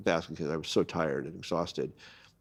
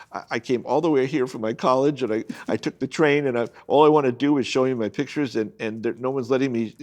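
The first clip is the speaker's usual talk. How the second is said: higher and louder, frantic pleading; a little hysterical; 5 semitones higher, 6 dB louder than usual. Retold or imitated. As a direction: blurt it out to the editor in a rising panic, higher and louder. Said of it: "I got a little hysterical".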